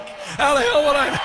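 high, shouted echo into laughter; host cracking up at the clip; laughing shout. Real, not acted.

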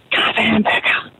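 breathy whisper, slow; whispered punchline. Acted. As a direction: lean in and whisper the order.

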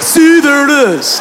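high, drawn-out excited exclamation; triumphant buddy. Retold or imitated. Buddy pointing at the spa sign, triumphant.

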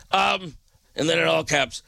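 ordinary talk, nothing marked

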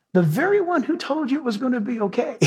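normal talking voice

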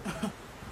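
soft breathy derisive laugh; quiet scornful chuckle; mocking laugh. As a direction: laugh her threat off. He laughs at her.

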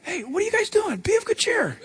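high, breezy and laughing; casual Jesus. Retold or imitated. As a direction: greet them casually with a laugh. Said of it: "Jesus walking along".

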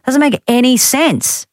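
high, slow incredulous protest; baffled disbelief. Real, not acted. Host rejecting the bird explanation, baffled. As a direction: pitch it up and draw it out, baffled.

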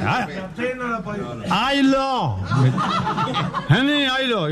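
put-on high drunken "ay, lo" without the P; hammed-up slurred "ay, lo", pitched high; drunk, dropped P. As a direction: stretch "ay, lo" as a drunk who cannot say P. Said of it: "No podía pronunciar la P".

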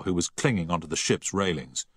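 ordinary delivery

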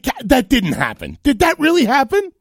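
loud incredulity climbing high; retold disbelief. Host hearing the clip back, astonished disbelief. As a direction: replay the shock, voice shooting up. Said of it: "I'm like".

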